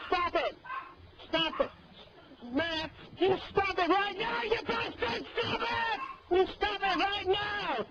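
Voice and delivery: high, angry shouting to make him stop; furious yelling. Real, not acted. Shouting at the troll Matt, furious and high. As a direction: yell at him to stop, real fury.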